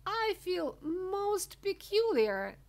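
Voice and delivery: high, slow, queasy whimper; drawn-out, high-pitched unease; gasped. A narrator's reading. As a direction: gasp it as a stricken girl. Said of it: "gasped Violet".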